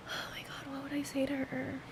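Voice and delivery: hushed, voiceless whisper to herself; overwhelmed whisper. Real, not acted. Podcaster wondering what to tell her younger self, overwhelmed whisper. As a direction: whisper it, overcome, barely getting it out.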